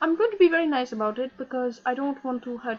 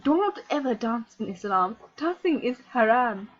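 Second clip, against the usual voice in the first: ordinary delivery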